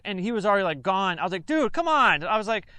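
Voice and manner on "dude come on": high, loud call after him; hey, don't go tell on us; pleading call. Retold or imitated. Soldier calls after the departing recruit, high and loud. Pleading with buddy leaving to snitch.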